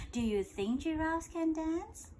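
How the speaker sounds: ordinary delivery, nothing marked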